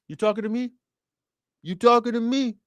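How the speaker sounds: high, frowning New York tough-guy voice; Robert De Niro impression. Streamer doing his De Niro for the chat, mock tough-guy. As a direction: scowl through a mock De Niro "You talking to me?". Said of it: "that's my Robert De Niro".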